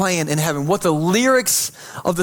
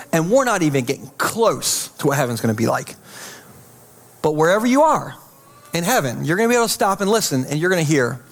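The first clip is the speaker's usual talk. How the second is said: usual speaking voice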